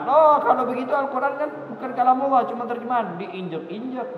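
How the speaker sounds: lightly mocking a doubter, pitch up; scornful doubter. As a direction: toss it off like a sceptic belittling the Quran. Membayangkan orang yang meremehkan Quran. (meniru ringan orang yang meremehkan, nada naik)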